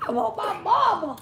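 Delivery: put-on high whiny kitten-baby voice; lightly voiced crying baby; mock baby voice. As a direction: whimper it like a lost little kitten. Voicing the kitten.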